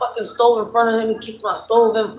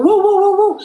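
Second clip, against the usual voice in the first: high, loud mock woofs; imitated dog barking; 13 semitones higher, 5 dB louder than usual. Storyteller imitates the dog barking, high loud woofs. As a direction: bark it out in quick repeated woos. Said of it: "The dog is barking".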